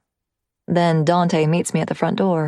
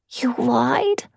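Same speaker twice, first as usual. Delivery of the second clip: higher child voice, a hint of shock; 7 semitones higher than usual. Lightly voiced boy learning mom lied, shocked. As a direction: caught off guard by mom. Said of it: "he says in shock".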